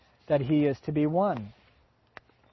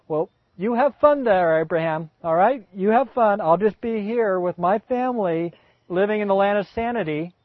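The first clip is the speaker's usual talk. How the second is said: sarcastic Sarah; 3 semitones higher than usual. Acted. Sarah mocking Abraham's plan, tart and sarcastic. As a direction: brush him off sarcastically. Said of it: "what she would say".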